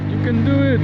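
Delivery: very high, loud cheer; cheering shout. Real, not acted. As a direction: cheer them on. Cheering friend on.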